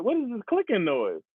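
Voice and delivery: high, incredulous self-quote; baffled reaction. Retold or imitated. Streamer mimics himself puzzled by clicking knees, high and incredulous. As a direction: ask it high-pitched and bewildered.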